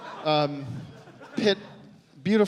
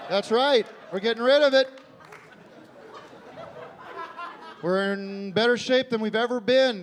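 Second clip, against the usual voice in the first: mock upbeat pitch; 6 semitones higher than usual. Mock political cheerleading.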